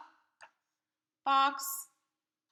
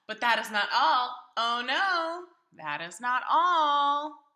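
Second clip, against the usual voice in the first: showing-off cat; 5 semitones higher, 6 dB louder than usual. Acted. Cat shows off balancing.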